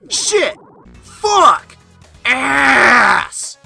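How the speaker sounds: string of high furious curses; raging gamer. Real, not acted. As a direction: lose it at the game. Repeated deaths, swearing.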